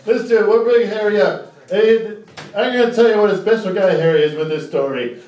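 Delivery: ordinary talk, nothing marked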